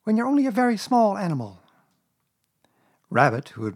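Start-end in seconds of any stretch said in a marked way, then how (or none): 0.0-1.6 high, timid little voice; sniffly Piglet. A narrator's reading. Piglet explaining his fear to Rabbit, a touch tearful. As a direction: excuse yourself meekly, pitched high. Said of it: "sniffing slightly".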